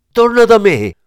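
high, loud anguished cry; desperate shout. A narrator's reading. Kleber screams after his lost love, high and loud. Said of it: "urlò".

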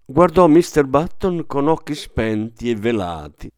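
plain reading voice

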